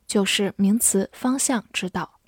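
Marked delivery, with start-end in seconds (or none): none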